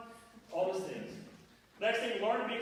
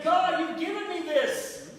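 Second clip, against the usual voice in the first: loud, high impassioned preaching; fervent prayer; 9 semitones higher, 7 dB louder than usual. Acted. Pastor praying aloud to God for joy in life, loud and fervent. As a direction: pour it out to God.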